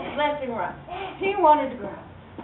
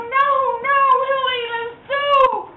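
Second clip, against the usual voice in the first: put-on scared kids; 12 semitones higher, 4 dB louder than usual. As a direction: plead it high and scared. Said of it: "They go".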